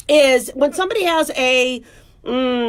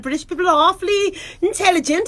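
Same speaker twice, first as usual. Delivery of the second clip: mock-refined British accent; 5 semitones higher than usual. Shown as a demonstration. Doctor playing at sounding intelligent, British accent. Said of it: "Sometimes I'll speak in a British accent".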